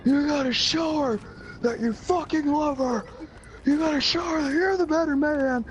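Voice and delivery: high, strained motivational shouting; impassioned pep talk. Retold or imitated. Rousing lovesick friend Joe to action, high impassioned shout. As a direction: re-enact a rousing drunken speech at full yell. Drunken inspirational speech to friend.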